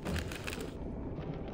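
sharp breathless gasp from spice; burning gasp. Real, not acted. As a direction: gasp as the chili hits. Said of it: "my mouth is on fire".

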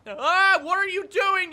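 loud, high outcry of frustration; exasperated self-scolding. Real, not acted. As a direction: yell at yourself for blundering. Scolding self while playing.

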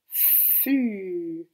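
"fu" étiré, fffuuu, f soufflé puis voyelle tenue; f allongé. Shown as a demonstration. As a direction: faire durer le f puis le u de "fu". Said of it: "qui fait le son f dans la syllabe". («fu» appuyé, les deux sons prolongés)

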